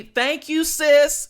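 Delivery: high, loud sing-out of thanks; rising to a loud, high finish; playful gushing thanks. Pretend. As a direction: call it out with teasing sass. Playful direct reply to commenter.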